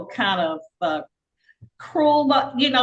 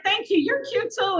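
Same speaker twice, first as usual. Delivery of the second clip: flattered reply; 11 semitones higher than usual. Retold or imitated. She answers the compliment.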